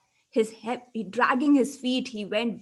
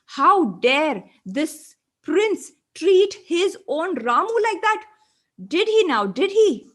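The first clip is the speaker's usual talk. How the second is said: loud, slow, outraged; seething at the insult; angry; 5 semitones higher, 6 dB louder, slower than usual. A narrator's reading. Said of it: "Now the spirit was angry".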